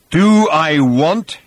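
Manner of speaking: very slow, loud, drawn-out threat; menacing hiss. A narrator's reading. Thor echoing Arthur's challenge, hissing menace. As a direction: stretch each word, seething. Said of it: "with a hiss like a river".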